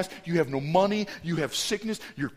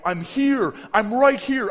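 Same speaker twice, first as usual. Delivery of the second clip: raised, urgent voice; urgent calling out; 9 semitones higher, 7 dB louder, slower than usual. Retold or imitated. Counselor reaches out to screaming kids, urgent raised call. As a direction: call out to be found over the panic.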